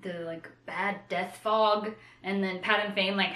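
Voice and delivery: usual speaking voice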